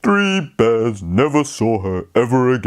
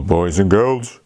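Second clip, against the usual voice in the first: ordinary delivery, nothing marked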